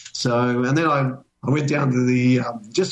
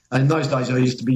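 ordinary delivery

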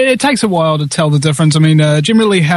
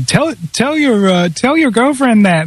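quoting her; 6 semitones higher than usual. Retold or imitated. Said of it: "she said to me".

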